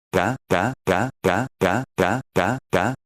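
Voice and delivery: lightly voiced baby babbling ta; baby babble. Baby babbling back at her father's question, lightly voiced. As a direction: babble the syllable ta like an infant.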